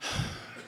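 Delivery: soft voiceless exhale; weary sigh. Retold or imitated. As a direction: let out a tired mother's sigh. Mother's weary breath before speaking.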